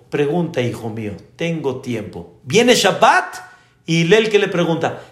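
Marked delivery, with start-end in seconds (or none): 0.1-2.4 soft, calm, fatherly reassurance. Retold or imitated. Hillel calmly inviting the man's questions, softly patient. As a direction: settle in and reassure him. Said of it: "así muy tranquilo y le dijo".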